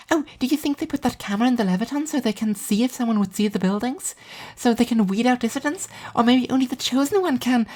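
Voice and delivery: put-on high, airy girl's voice for Alice; Alice's voice. A narrator's reading. Narrator voicing Alice musing about new rats, high girl's voice. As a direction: voice the odd, dreamy friend lightly and high.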